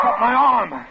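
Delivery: hurt, gasping yell; pained shout. Acted. Husband shouts as he is cut. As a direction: shout it as the glass cuts.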